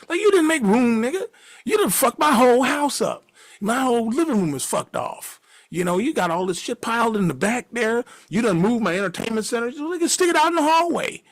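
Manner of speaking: high, heated rant; angry scolding. Retold or imitated. Friend scolding roommate about mess.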